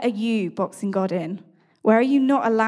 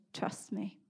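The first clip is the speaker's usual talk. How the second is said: quiet divine voice; 12 dB quieter than usual. Retold or imitated. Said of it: "the voice then said to me".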